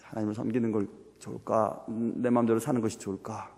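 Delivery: low, soft musing to oneself; weighing it up. Acted. Believer sitting on the fence, weighing options in a low murmur. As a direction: quietly mull over two options. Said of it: "이렇게 막 재는 거죠".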